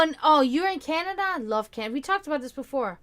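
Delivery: ordinary delivery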